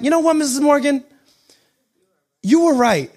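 high-pitched, emphatic admission to a teacher; acted, high-pitched realization; emphatic concession. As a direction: tell an old teacher, with rising conviction. Imagined address to former teacher.

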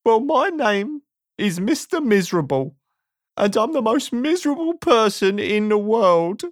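sad, squeaky, whining voice; high-pitched self-pitying moan; Mister Miserable. A narrator's reading. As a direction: introduce yourself in a high, woeful squeak. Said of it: "a sad, squeaky sort of voice".

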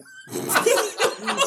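high, breathy laughing fit; burst of laughter. Real, not acted. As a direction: crack up at your own joke. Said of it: "I don't know where that came from".

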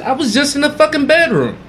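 high, exasperated insistence; exasperated protest. Retold or imitated. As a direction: plead your innocence, pitched high. Protesting innocence.

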